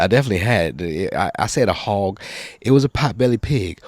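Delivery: ordinary talk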